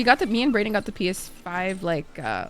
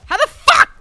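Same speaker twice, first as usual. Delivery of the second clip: high, loud exclamation; loud, incredulous burst; baffled outburst; 11 dB louder than usual. Real, not acted. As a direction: shoot up high in loud disbelief.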